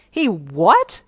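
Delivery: loud, shocked rising question; shriek. Acted. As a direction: shriek it in disbelief. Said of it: "she shrieked".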